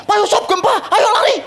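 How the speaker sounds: high, urgent mock panic. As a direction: beg the pastor to flee. Woman urges pastor to run. (desakan panik yang ditirukan)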